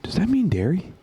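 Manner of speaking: high, puzzled; clueless question. Retold or imitated. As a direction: ask it like you truly don't know. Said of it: "He goes".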